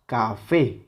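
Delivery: "café" with a drawn-out, stressed "fé"; acento en la última sílaba. Shown as a demonstration. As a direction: lean on the final "fé" of "café". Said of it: "es la que suena más fuerte". ("café" con "fé" fuerte)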